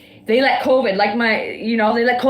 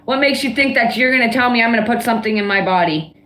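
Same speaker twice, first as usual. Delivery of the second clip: mad; 3 semitones lower than usual. Real, not acted. Ranting to viewers about being forced into a microchip, furious. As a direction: rage against being told what to do. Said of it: "She must be really mad at someone right now".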